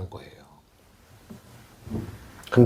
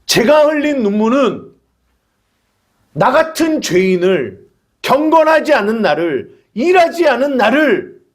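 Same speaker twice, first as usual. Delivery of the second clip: ordinary talk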